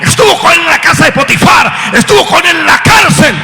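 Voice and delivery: loud, high preacher's shout over music; preaching at full force. Acted. Preacher to congregation about God's presence, full-force preaching. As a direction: drive it home to the congregation. Sermon climax.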